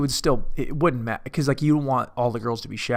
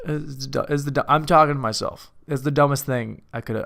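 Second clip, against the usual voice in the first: ordinary delivery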